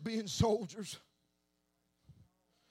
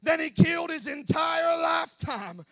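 preaching at full force; 7 semitones higher, 8 dB louder than usual. Acted. Preacher declaring Samson's sacrifice, full-force shout. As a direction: shout it out over the music.

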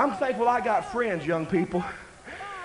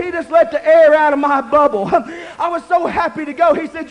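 ordinary delivery

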